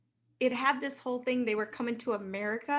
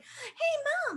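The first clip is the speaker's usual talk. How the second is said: put-on chirpy kid; 14 semitones higher than usual. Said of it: "He's like".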